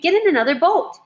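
high-pitched urgent command; narrated scream. A narrator's reading. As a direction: cry out an order in alarm. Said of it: "her mother screamed".

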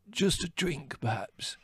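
parched voice, like a corncrake; parched croak. A narrator's reading. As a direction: croak it out with a dry throat. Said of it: "in a parched voice like a corncrake".

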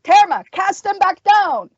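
high, loud shouted order; shouted demand. A narrator's reading. Fighter to the witch as corpses approach, shouting. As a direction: yell it urgently to the witch. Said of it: "he, he shouted".

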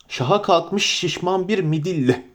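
usual speaking voice

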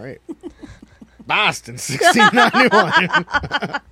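high, loud, slowed through laughter; cracking up mid-read; words stretched out by giggles; laughing. Real, not acted.

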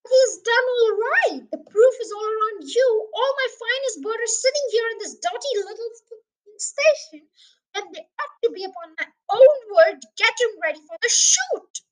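loud, high-pitched furious tirade; erupting angrily. A narrator's reading. Mister Hazel complains about his birds before the shoot, erupting angrily. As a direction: let the words pour out like hot lava. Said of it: "words poured out of Mister Hazel's mouth like hot lava".

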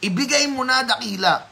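lightly acted grandfather stepping in, high pitch; intervening grandfather. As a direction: urge the child to hand it over. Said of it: "my father decided to intervene".

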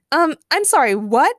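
high, loud, incredulous interruption; cutting in. A narrator's reading. Jesse interrupts the masked man, incredulous. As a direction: butt in, taken aback. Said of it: "cut in Jesse".

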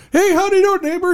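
very high, mock-friendly hello; chirpy neighbor greeting. Friend imagines a new neighbor saying hello, chirpy mock greeting.

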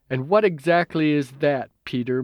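ordinary delivery, nothing marked

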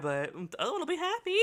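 high-pitched and fast, sing-song sarcasm; chirpy parody of a bad deal; mock cheerful. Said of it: "She's like".

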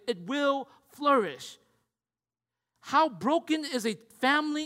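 forceful, stretched-out emphatic exhortation; impassioned preaching. Real, not acted. Preacher urging brothers to care and protect, impassioned. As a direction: drive each phrase home from the pulpit.

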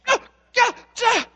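imitated panicked lady shrieking; panicked shrieks. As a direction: imitate a frightened lady yelping. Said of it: "she starts to panic".

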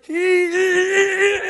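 long, high, loud "ee"; imitated little boy's wound-up squeal; excited toddler squeal. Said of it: "when he gets really excited".

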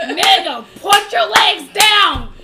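loud, slow outraged yell; loud protest. Real, not acted. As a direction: drag it out in disbelief.

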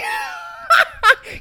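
very high, loud cackle; high crying laugh; loud laughing wail. Real, not acted. Laughing at her own confession.

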